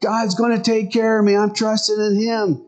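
swimmer waving off the rescuer; half-hearted mock yell of refusal; mild mock yell. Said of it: "the guy yells and says".

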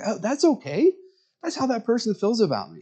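a touch of Steve brushing it off; voicing Steve. Pastor voicing Steve shrugging it off.

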